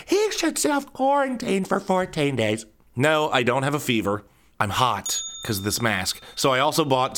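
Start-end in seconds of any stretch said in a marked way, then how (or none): 0.1-2.6 high, prissy mock worried bystander; imitating onlookers. Bystanders whispering about the sweating bald man, high mock worry. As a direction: gossip about him like a nervous neighbour. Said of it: "and they're".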